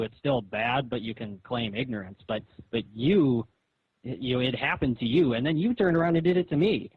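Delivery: ordinary talk, nothing marked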